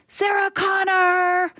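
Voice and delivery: high, drawn-out call of the name; retold call. Fan spotting her on the street, calling the name high. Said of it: "This was fully just".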